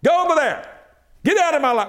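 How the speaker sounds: high forceful command; commanding obstacle. Acted. Preacher ordering an obstacle away, high and forceful. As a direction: cast it away with authority.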